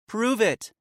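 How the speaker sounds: a wary woman demanding proof. A narrator's reading. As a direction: challenge her to prove it. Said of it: "a woman demanded".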